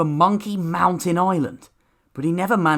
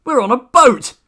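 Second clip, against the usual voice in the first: bursting with excitement, higher and louder; excited; 7 semitones higher, 6 dB louder than usual. A narrator's reading. Milton realises they are on a boat, excited cry. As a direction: shout the discovery with glee.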